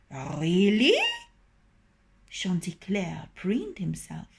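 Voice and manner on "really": flattered surprise. Acted. Flattered rooster's question.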